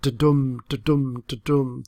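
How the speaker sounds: "da-dum" with weak "da" and stronger "dum"; iambic meter. Shown as a demonstration. Lecturer showing the poem's rhythm, "da-dum" stressed on DUM. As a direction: beat out "da-dum" evenly, stress on each "dum". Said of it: "this iambic meter".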